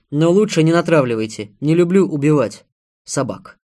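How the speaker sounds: loud, forceful caution; armed man's firm call; firm warning. A narrator's reading. Armed man warns the women.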